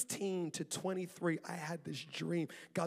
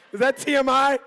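loud laughing aside; 3 semitones higher, 16 dB louder than usual. Real, not acted.

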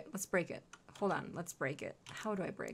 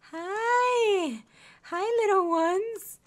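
slow, high, doting hello; cooing to puppies; 9 semitones higher, 4 dB louder, much slower than usual. Real, not acted. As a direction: coo at tiny pets, pitched up. Greeting cute puppies.

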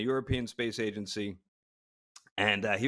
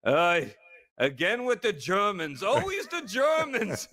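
slow, higher mock German complaint; weary German grumble; 8 semitones higher, slower than usual. German classmate sighing at the docent, weary mock grumble. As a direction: sigh it out, fed up with hearing about the Germans.

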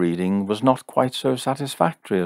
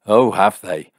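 loud, indignant challenge; irritated, forceful question; demanding; 2 semitones higher, 8 dB louder than usual. A narrator's reading. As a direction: hit it hard as a sceptical retort. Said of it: "demanded Jim".

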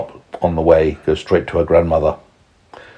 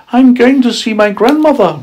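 put-on very high, innocent young girl; sweet child's voice; little girl voice; 5 dB louder than usual.

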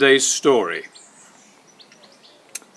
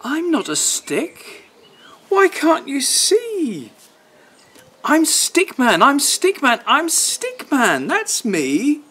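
Stickman pleading; 9 semitones higher than usual. Acted. Stickman begging the dog to see him, high plaintive. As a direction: plead to be understood.

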